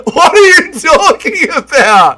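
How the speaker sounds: very high, slow, stretched-out disbelief; incredulous outcry. Real, not acted. Streamer protesting chat's claim that Mendez beats Volk, incredulous wail. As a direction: wail each question in exasperated astonishment.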